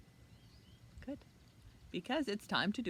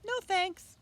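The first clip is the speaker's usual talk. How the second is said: put-on much higher, light girlish refusal; little girl voice; 14 semitones higher, 9 dB louder than usual. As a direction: say it like a small girl. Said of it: "Annabelle shook her head".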